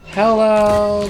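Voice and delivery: high, loud drawn-out hello; loud sing-song greeting. Pretend. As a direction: call out to the bug happily. Greeting a game creature.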